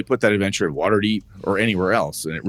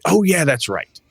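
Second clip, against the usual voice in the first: sudden recognition; 9 semitones higher, 3 dB louder, slower than usual. Acted. Player suddenly recalls the lore.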